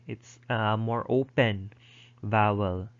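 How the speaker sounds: usual speaking voice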